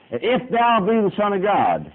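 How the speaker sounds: high, slow, sly tempter voice; drawn-out mocking devil; the devil tempting. Preacher voicing the devil to his church, high and slow. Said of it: "what the devil says when he speaks".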